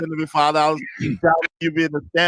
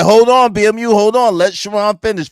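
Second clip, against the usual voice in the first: loud retold interruption; 3 semitones higher, 7 dB louder than usual. Retold cutting off a panelist.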